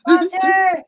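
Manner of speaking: high, loud call to the dog; excited call. Real, not acted. Calling the dog.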